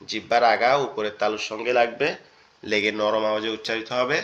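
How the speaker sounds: ordinary talk, nothing marked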